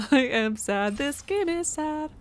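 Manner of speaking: slow, drawn-out declaration of sadness; sad. Real, not acted. Streamer reacting to a sad game, slow and woeful. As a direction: stretch out each word in deliberate woe.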